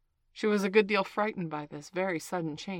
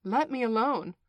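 louder, higher indignant rebuff; 4 semitones higher, 8 dB louder than usual. A narrator's reading. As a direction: push them away with offended force. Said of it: "said Alice indignantly".

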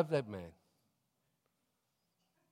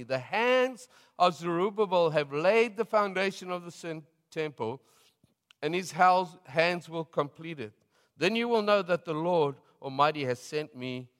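a touch louder and higher scripture read; preaching scripture; 4 semitones higher, 3 dB louder than usual. Acted. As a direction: read it as a prophetic declaration. Reads prophecy in preaching voice.

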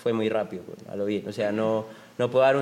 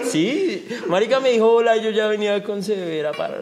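laughing hard while telling it; high laughing outburst; 9 semitones higher, 4 dB louder than usual. Retold or imitated. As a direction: blurt it out through laughter. Laughing story of girl greeting.

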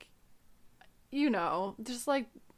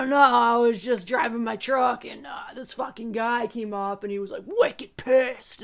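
loud, blustering Boston uncle impression; Adam Sandler-like Boston accent, hammed up; thick Boston accent; 9 dB louder than usual. Imitating Italian uncle telling his truck story, thick Boston accent. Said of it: "thickest fucking Boston accent".

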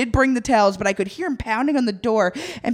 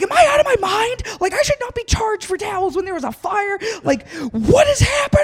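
much higher, exasperated disbelief; incredulous protest; 12 semitones higher than usual. Retold or imitated. Guest charged for towels after fire, high incredulous protest. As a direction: squeal it in outraged disbelief.

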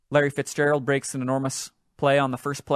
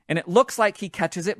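ordinary talk

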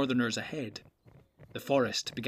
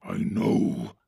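growled; 4 semitones lower, 3 dB louder than usual. Acted. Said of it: "growled Ugluk".

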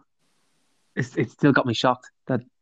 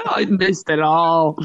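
mock lament; 4 semitones higher, much slower than usual. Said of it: "coffee guy voiced".

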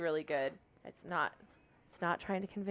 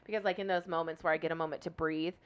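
ordinary delivery

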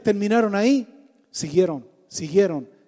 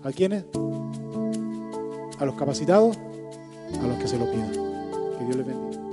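soft blessing; 5 semitones lower, 4 dB quieter than usual. Real, not acted. Closing blessing over music. (bendición final suave y pausada)

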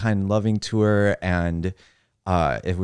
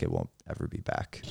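hushed whisper, much softer; whispered aside; 12 dB quieter than usual. Retold or imitated. Mocking friends in Texas, whispering. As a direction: whisper it like a secret.

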